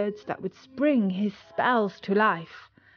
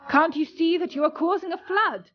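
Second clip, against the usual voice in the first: alarmed scolding; 8 semitones higher than usual. A narrator's reading. Boy scolds the flooding broom.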